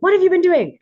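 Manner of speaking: high, loud imitation of an impatient client; mock demanding client. Voicing client's demanding phone call.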